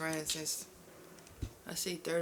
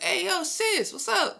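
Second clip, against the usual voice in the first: high, loud, hyped-up hey yo sis; excited greeting; 13 semitones higher, 8 dB louder, slower than usual. Retold or imitated. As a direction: greet her like a long-lost sister, way up high. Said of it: "He was like".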